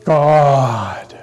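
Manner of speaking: "God" held long, falling away; stress on GOD. Shown as a demonstration. Preacher polling the congregation, "God" drawn out. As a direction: stretch "God" out with weight. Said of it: "How many of you say".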